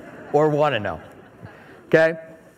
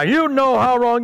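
mock stern dad; 6 semitones higher, 4 dB louder, slower than usual. Angry father confronts son.

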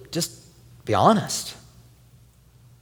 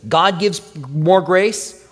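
slow emphatic quote; 2 semitones higher than usual. Shown as a demonstration. Quoting scripture emphatically.

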